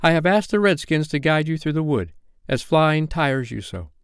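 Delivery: mildly commanding; short, sharp command. A narrator's reading. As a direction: speak as one used to being obeyed. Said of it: "sharp voice of one accustomed to be obeyed".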